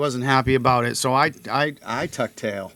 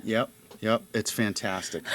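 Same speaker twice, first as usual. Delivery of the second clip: normal talking voice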